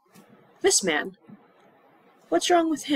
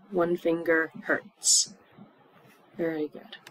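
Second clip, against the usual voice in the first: ordinary talk, nothing marked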